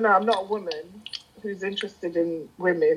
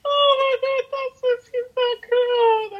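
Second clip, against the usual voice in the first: gushing. Real, not acted. Swooning over the panther voice.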